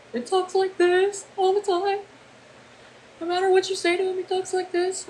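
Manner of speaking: put-on very high, soft childlike voice all through; mock the character's odd high voice; imitating Ed Gein's voice. Telling chat how the character talks, very high. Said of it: "to playing Ed Gein".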